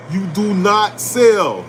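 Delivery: each word slow and weighted, "you do not sell"; slow emphatic rule. Real, not acted. Drilling the rule into viewers, slow and firm. Investing rule hammered home.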